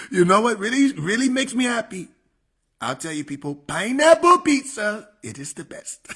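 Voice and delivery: high politician caricature with big swings in volume; Trump. Acted. Comedian as the president praising pineapple pizza, Trump impression. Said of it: "Trump impression was spot on".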